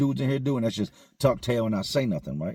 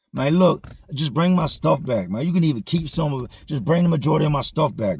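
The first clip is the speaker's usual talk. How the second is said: loud, higher call across the dorm; calling out again; 4 semitones higher, 4 dB louder than usual. Retold or imitated. Robbed inmate calling out to the whole dorm again, loud and insistent. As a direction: shout out to everyone, pushing harder this time.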